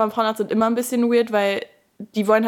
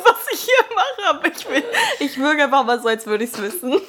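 mock clueless dad; 6 semitones higher, 6 dB louder than usual. Voicing a clueless handyman father.

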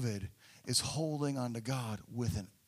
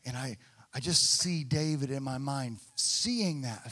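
ordinary talk

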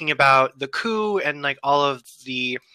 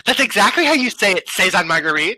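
quick, raised-pitch mock mean-girl retort; 4 semitones higher, 4 dB louder than usual. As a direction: imitate a snide cartoon mean girl. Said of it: "one of the mean girl characters".

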